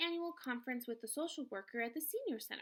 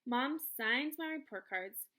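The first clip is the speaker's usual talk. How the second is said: plain reading voice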